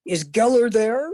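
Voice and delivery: imitated New York accent, asking over the phone. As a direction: put on a New York guy's voice. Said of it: "Fred had this New York accent".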